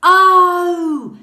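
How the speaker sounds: big loud oh; loud oh showing the loud voice. Shown as a demonstration. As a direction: belt it out for the class. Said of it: "say it in your loud voice".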